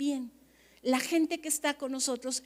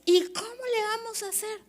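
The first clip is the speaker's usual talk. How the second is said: mock worried pastor; 8 semitones higher than usual. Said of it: "nos preguntamos como pastores".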